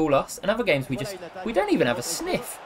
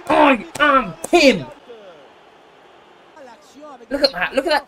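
triumphant shout; 7 semitones higher, 6 dB louder than usual. Real, not acted. Streamer crowing after his goal, loud triumphant shout. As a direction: roar it out word by word.

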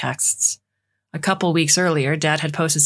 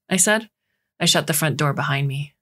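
usual speaking voice

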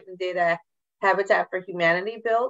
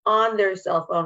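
usual speaking voice

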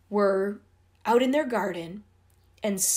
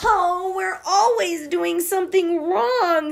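put-on high, loud girlish wail; despairing wail; 9 semitones higher, 5 dB louder than usual. A narrator's reading. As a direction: whine in despair over the mistake. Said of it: "said Debbie".

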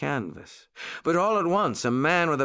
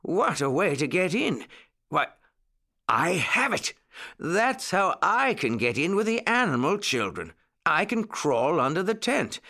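higher-pitched, delighted brainwave; storybook excitement, pitched up; excited exclamation; 7 semitones higher than usual. A narrator's reading. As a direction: exclaim as the plan comes to you. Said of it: "exclaimed Mister Longears".

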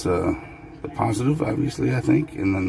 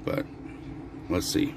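usual speaking voice

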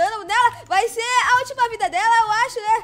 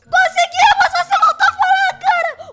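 high, loud elated cheer; ecstatic, high-pitched celebration; overjoyed shouting; 8 semitones higher, 7 dB louder than usual. Real, not acted. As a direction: shout with joy for your friend. Celebrating friend can talk again.

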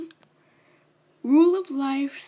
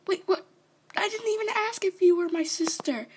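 higher, fast confused protest; startled protest; 5 semitones higher, faster than usual. Acted. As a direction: blurt it out, caught off guard. Startled by the sister claim.

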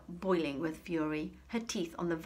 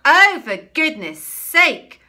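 slow, loud, high exasperated roar; 10 semitones higher, 21 dB louder, slower than usual. A narrator's reading. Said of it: "roared the headmistress".

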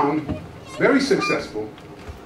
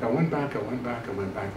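each repeat quieter and lower until almost gone; fading repetition; 7 semitones lower, 10 dB quieter than usual. Real, not acted. Preacher recalls returning to church again and again, fading off. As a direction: let the words die away one by one.

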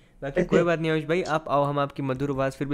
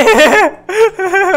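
loud high shrieking laugh; burst of laughter; 12 dB louder than usual. Real, not acted. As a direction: explode into a shrill laugh. Teammate crashed in race.

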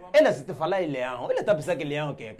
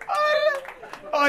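squeaky mock call; 3 dB louder than usual. High mock voice.

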